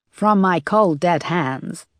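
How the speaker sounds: hammed-up American accent, half-hearted movie-star growl; bad American accent as Charlton Heston. Moss teasing her boss with a bad American accent. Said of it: "said Moss in a bad American accent".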